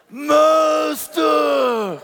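high, long-held leper wail. Shown as a demonstration. Preacher urging shy congregation to copy his high leper wail. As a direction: cry it out high, stretching both syllables. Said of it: "this is leprosy".

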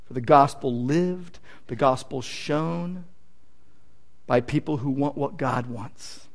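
soft, slowed closing plea; hushed pastoral appeal; quiet earnest appeal. Acted. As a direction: draw them in softly.

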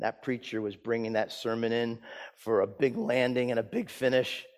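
ordinary delivery

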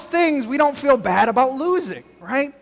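preacher pumping up the crowd about lost phones; fired-up preaching. Acted. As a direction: build energy for the congregation. Preacher rises to excited pitch.

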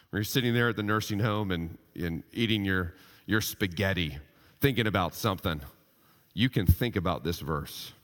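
usual speaking voice

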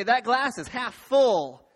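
loud, slow and rousing; big, drawn-out optimist's question; upbeat half full. Shown as a demonstration. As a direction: belt it out to rally the room. Polls the room for optimists.

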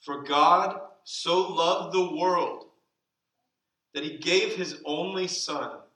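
fervent, drawn-out reading of the verse; slow, swelling proclamation. Shown as a demonstration. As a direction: lift the verse up like a declaration. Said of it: "I'm gonna read it twice".

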